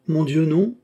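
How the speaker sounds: lifted, matter-of-fact denial; artless, easy denial; perfectly simple tone. A narrator's reading. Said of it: "dit le cardinal d'un ton parfaitement simple".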